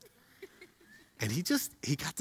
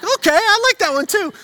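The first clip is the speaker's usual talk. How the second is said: much higher and louder, gleeful; excited kid; 10 dB louder than usual. Retold or imitated. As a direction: squeal it like a thrilled kid. Son voiced delighted.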